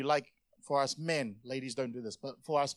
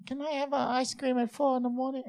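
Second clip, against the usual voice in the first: high, put-on little wheedle; pleading girlfriend imitation; 9 semitones higher than usual. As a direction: mimic a partner begging for a treat. Mimics fiancée's ice-cream request.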